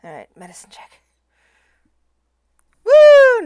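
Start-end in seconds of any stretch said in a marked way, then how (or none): 2.8-3.4 loud high victory whoop; excited whoop. Real, not acted. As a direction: cheer a lucky roll. Rolled natural twenty.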